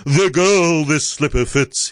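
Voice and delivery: high, declaiming prince; princely cry. A narrator's reading. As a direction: proclaim it as a lovestruck prince. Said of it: "he cried".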